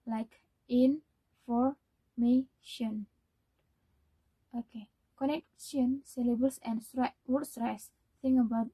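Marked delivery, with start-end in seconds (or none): 0.7-3.1 very slow, each syllable of information pulled apart. Shown as a demonstration. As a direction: spell out in-for-ma-tion slowly, beat by beat. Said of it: "information has four, four syllables".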